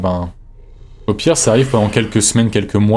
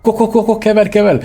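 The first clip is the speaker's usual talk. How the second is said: high, rapid-fire repeated cries; frantic urging; 10 semitones higher, 3 dB louder, faster than usual. Real, not acted. Player fleeing danger in the game, frantic. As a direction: urge the getaway in a high voice.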